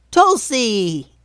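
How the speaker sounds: high goblin voice, drawn-out call; delighted goblin greeting. A narrator's reading. Goblin King spotting his old friend, delighted high call.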